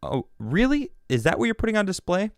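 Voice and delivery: high sarcastic "oh really"; sarcastic retort. Retold or imitated. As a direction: toss it back with mock surprise. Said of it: "Trump's team is going".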